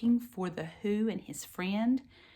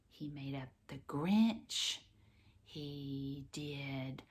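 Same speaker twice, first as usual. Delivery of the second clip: whispering; 5 semitones lower, 7 dB quieter, slower than usual. Real, not acted. Soft aside to child.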